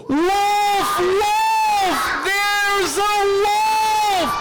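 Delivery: high, drawn-out cry of wolf; long, sustained high yell; screaming alarm. Acted. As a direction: holler it from a mountaintop. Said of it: "he screams at the top of his lungs".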